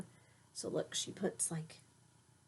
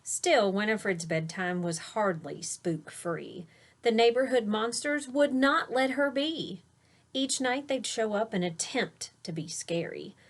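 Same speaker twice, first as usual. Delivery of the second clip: put-on lilting rhyme read to a child; read-aloud storytelling; 3 semitones higher, 4 dB louder than usual. A narrator's reading. Parent reading a rhyming bedtime book aloud to a child. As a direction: read the verse with a gentle bounce.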